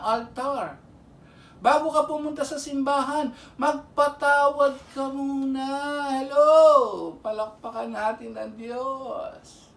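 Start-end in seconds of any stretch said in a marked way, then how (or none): 6.1-7.1 a loud, falling call-out; loud hello. Real, not acted. Preacher rousing his listeners, loud call.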